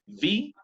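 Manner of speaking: V with teeth on the lip; labiodental V. Shown as a demonstration. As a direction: say V as a labiodental sound. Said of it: "ventilabial, dientes, labio".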